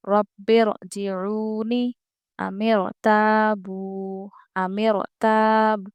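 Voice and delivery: slow रब्बिरजिऊनी, अमिरताबू, अमिरताब with a full ra; heavy ra recitation. Shown as a demonstration. Teacher reciting examples slowly with a heavy ra. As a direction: draw each word out, ra heavy. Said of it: "यहाँ पर रॉ हम पुर पढ़ेंगे".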